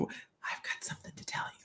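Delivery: hushed, secretive whisper; mock whisper. To a stranger, a mock secretive whisper. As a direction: lean in like sharing a dark secret. Mocks a secretive psychic approach.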